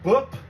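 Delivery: loud clear letter demo; Korean consonant demo. Shown as a demonstration. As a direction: sound them out crisply for the class. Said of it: "This is M".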